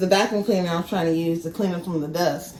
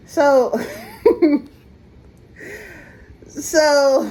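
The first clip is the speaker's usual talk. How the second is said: laughing; 7 semitones higher, 5 dB louder than usual. Real, not acted. Laughs at her own mix-up.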